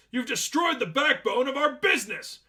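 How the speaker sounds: high, loud furious manager yell; furious shout. A narrator's reading. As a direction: scream it red-faced in fury. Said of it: "The manager's face turned red in fury".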